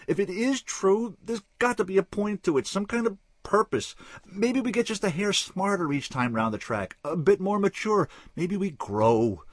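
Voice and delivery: high, loud stammering urgency; desperate frantic plea. Acted. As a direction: plead like a man unravelling. Wild-eyed man pleads, stammering.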